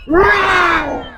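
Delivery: a big playful bear roar shouted out; big loud roar. Shown as a demonstration. Teacher showing the kids a big loud bear roar. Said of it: "Big loud roar".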